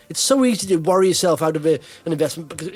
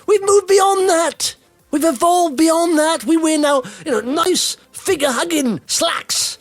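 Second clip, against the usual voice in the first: mock pompous; 8 semitones higher than usual. Hammed-up argument for trousers.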